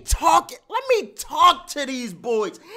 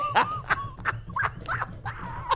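high shrieking laugh; 11 dB quieter than usual. Real, not acted. Reacting to own bar.

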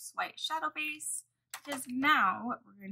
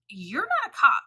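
retold angry snap; 4 semitones higher, 10 dB louder than usual. Retold quarrel over parking spot.

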